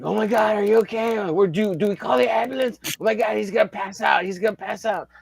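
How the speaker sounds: high-pitched, fretting onlookers; imitated panic. Onlookers fussing over a man hurt in a fall, panicky.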